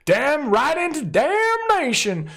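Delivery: high, drawn-out punning cry; hammed-up excitement. Host reveals Damnation with a pun, hammed-up excited call. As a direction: stretch the pun out high and big.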